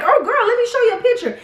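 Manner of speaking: lightly voiced excited relative; voicing a relative. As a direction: chirp it like an eager auntie. Family voiced in story.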